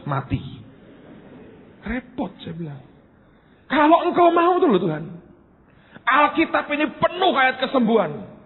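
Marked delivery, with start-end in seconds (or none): 3.7-8.3 high, insistent retold prayer; blunt complaint to God. Preacher arguing with God about unhealed sick people, blunt. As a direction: press God bluntly, pitched well up. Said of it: "tidak saya perhalus lah".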